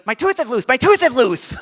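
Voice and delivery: put-on high, loud child's voice; excited little kid. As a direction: announce it like a thrilled seven-year-old. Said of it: "he's all excited".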